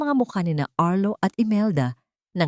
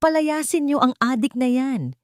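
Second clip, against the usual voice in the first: Madam Imelda ordering the guards out, voice pitched up in panic; frantic order; 7 semitones higher than usual. A narrator's reading. Matriarch yelling at security, high and shrill with outrage. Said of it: "Pilit na tinatawag ang mga security".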